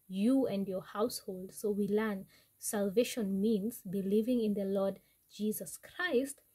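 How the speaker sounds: normal talking voice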